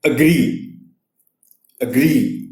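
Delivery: Agree with the weight on the final gree; teacher modelling agree stressed at the end. Shown as a demonstration. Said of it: "stress جو ہے وہ آر پر ہے".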